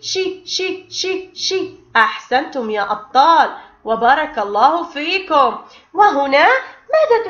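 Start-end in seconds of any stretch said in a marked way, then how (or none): 0.0-1.7 hushed repeated شي, hissing sh; breathy شي شي like a soft shush; letter sound demo. Shown as a demonstration. Teacher sounding out shin with kasra, hushed. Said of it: "وصوته".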